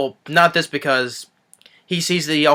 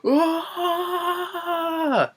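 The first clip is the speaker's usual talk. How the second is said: drawn-out high "aah" imitating a terrified passenger; mock scream; 4 dB quieter than usual. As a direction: hold a thin, held-back pretend scream. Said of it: "as he screams like".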